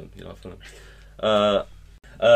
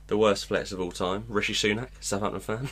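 ordinary talk

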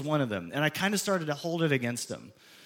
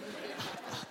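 quiet breathy chuckle; 17 dB quieter than usual. Real, not acted. Laughs at the punchline.

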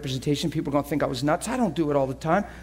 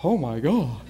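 low, dismayed aside; appalled onlooker; 5 semitones lower than usual. Retold or imitated. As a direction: mimic a bystander shocked from afar. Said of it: "looking from a distance thinking".

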